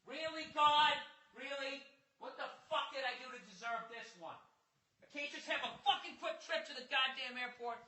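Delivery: high, soft, whining complaint to the sky; high whimpering why-me voice; whiny plea to God. Retold or imitated. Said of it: "I start doing that".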